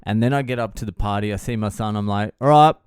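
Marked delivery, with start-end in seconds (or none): 2.4-2.7 loud, higher round-up call; brisk acted shout to leave. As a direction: call your kid to go. Calls son to leave party.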